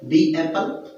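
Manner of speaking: ordinary delivery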